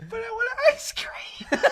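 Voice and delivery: high, slow, laughing cry of a whine; mock whine through a laugh; whiny quote laughing. Quoting a friend wanting ice cream, laughing whine. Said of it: "she goes".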